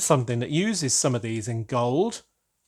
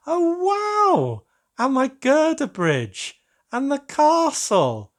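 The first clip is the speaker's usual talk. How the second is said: much higher, slow delighted wonder; thrilled; 11 semitones higher, slower than usual. Real, not acted. Finding his own builds painted, high thrilled wonder. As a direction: gush as you spot each build.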